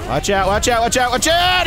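from urgent to a high shout; rising warning. Real, not acted. Gamer warning the team, building to a high shout.

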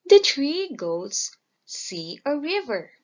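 ordinary delivery, nothing marked

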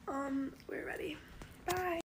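ordinary talk, nothing marked

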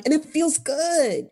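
slow, high New York drawl; New York accent. Shown as a demonstration. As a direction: savor the old accent. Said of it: "I talk like this".

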